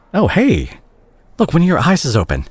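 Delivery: sudden high, fast gasp of surprise; jolted, high-pitched excitement; startled surprise. Acted. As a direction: caught off guard as the eye opens. Patient wakes from coma.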